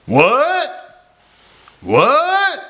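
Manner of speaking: very high, loud, incredulous what; loud upset question. Shown as a demonstration. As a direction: shriek it as question and exclamation at once. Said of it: "So you're getting louder".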